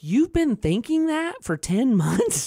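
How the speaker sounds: high and laughing, amazed; laughing disbelief. Retold or imitated. Dad amazed his son held this for months, laughing. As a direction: marvel through a laugh.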